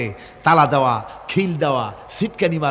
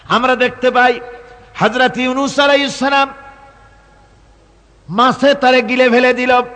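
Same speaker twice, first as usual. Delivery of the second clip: high, raised declamation in separated phrases; impassioned preaching; 9 semitones higher, 5 dB louder than usual. Real, not acted. Preacher tells crowd of Yunus and the fish, impassioned preaching. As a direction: proclaim it to the crowd, pausing between phrases.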